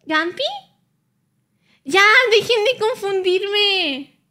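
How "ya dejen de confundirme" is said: loud, drawn-out whine; stretched-out, exasperated complaint; exasperated protest. Real, not acted. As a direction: plead slowly and loudly for them to stop. Begs chat to stop confusing her.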